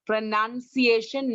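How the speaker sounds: "pronunciation" slowly with NUN, not NOW; correct pronunciation. Shown as a demonstration. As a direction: stretch out "pronunciation" as pro-NUN-ciation. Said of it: "not now".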